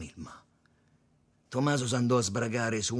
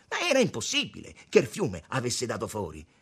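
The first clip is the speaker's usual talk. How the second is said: plain reading voice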